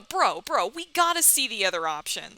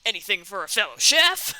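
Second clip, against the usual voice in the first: lightly hammed-up Colonel, loud; hammy Colonel; 8 dB louder than usual. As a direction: play a hearty game character, a little. Voicing the Colonel's reply.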